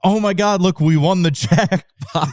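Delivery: squealing with a laugh; mock excited. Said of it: "But it was like".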